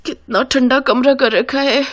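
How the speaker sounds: high-pitched shivery complaint; shivering. Acted. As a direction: puff with cold as you speak. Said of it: "कांपते हुए उस कमरे से बाहर". (ठंड से कांपती आवाज़)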